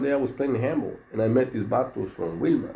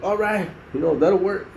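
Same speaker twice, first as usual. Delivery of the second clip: higher, sly voice of the other inmate; mock agreeable reply; 8 semitones higher than usual. Inmate agreeing to wait for package, higher and sly. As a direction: play it slyly agreeable, voice lifted. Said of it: "In Spanish, right?".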